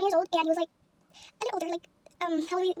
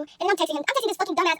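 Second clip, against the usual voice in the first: normal talking voice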